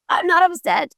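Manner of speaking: "not" jumps high, then a dismissive fall; so-whatever tone. Shown as a demonstration. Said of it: "But the way they said it".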